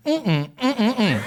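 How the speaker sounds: mock disapproving mm-mm; disapproving hum. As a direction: hum a scandalised no. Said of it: "she went like this".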